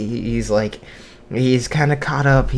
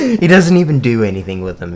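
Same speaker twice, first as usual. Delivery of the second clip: loud, quick, laughing delivery; 7 dB louder, faster than usual. Real, not acted. Teller on the son hoarding ping pong balls, laughing. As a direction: crack up while telling it. Cracks up at own joke.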